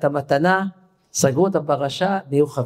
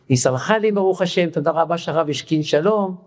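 ordinary delivery

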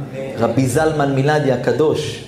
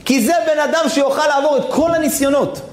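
high, sustained proclamation; impassioned preaching; 12 semitones higher than usual. Acted. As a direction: proclaim it high, like a sermon's climax. Climax of the sermon's exhortation. (הכרזה נלהבת בקול גבוה)

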